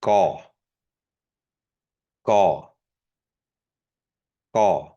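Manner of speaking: Gaw with a tight unaspirated k-like g; bracketed G. Shown as a demonstration. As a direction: Gaw between k and g. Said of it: "The bracketed G".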